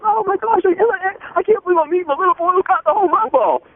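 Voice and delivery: imitating a starstruck man in a squeaky voice; starstruck gushing. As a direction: gush like meeting a celebrity. Man meets the boy, retold.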